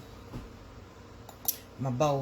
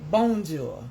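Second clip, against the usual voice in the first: French greeting; 3 semitones higher, 6 dB louder than usual. Shown as a demonstration. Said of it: "That's my French".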